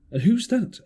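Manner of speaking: a lightly voiced squire asking sharply; squire's question. A narrator's reading. Squire asking the captain who to trust, lightly voiced. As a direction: ask it up high, eager. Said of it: "asked the squire".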